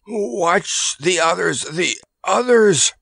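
high, slow, strangled elephant warning; gulped warning. A narrator's reading. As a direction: gulp out the warning, dragging the words. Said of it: "gulped Kabumpo".

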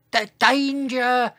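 high, drawn-out warning with a stammered start; second, longer shout. A narrator's reading. Dinosaur trying harder to warn others, long high shout. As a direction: stretch the small high call out slowly. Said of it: "He tried again".

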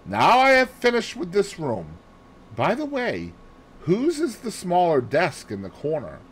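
high-pitched detective voice; Poirot, businesslike and high; brisk Poirot. Acted.

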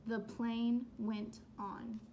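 ordinary delivery

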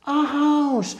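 very high, stretched storyteller awe; hammed-up amazement for toddlers; amazed wonder. Shown as a demonstration. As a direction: marvel as if it's the world's most spectacular thing. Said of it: "como si fuera la cosa más espectacular del mundo".

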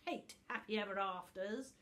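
loud, snarling creature voice; the wicked book snarls at Tuft. Acted. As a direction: spit out the hatred of happy endings. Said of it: "Book made a snarling noise".

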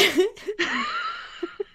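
squealing burst of laughter; real high-pitched giggle. Real, not acted. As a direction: shriek with delight. After kangaroo patronus.

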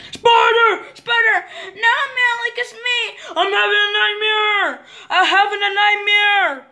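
high, frantic crying out; nightmare screams. Pretend. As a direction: wail that it's a nightmare.

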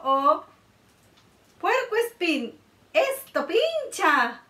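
ordinary talk

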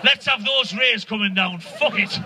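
mock defiant growl. Comic acting himself spraying aerosol out the window, low gruff voice. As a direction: growl it like a man spraying the sky. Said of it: "I'm out the windows like that".

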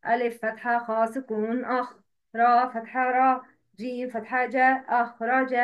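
"ألف فتحة خاء سكون أخ" chanted letter by letter; spelling by tongue. Shown as a demonstration. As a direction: spell out "أخرج" by letter, vowel and sound, then join it. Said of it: "تهجي اللسان مش كده". (تهجي "أخرج" حرفًا حرفًا بالحركات)

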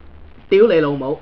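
屌你老母 spoken as a Cantonese lesson word; Cantonese word of the day. Shown as a demonstration. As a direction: teach 屌你老母 clearly in Cantonese. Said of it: "Chinese, uh, word of the day".

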